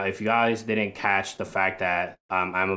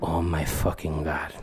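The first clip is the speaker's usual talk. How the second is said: lightly put-on accented exclaiming; grandmother's accent; 4 semitones lower than usual. Comedian admires a sandwich, lightly imitating grandma's accent. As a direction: marvel in a hint of grandma's accent. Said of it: "her accent just stuck with me".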